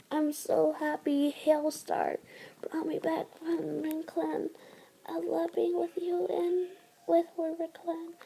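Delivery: a fading queen's breathy farewell; faint dying whisper. Acted. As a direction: breathe out her final wishes. Dying mate's last words.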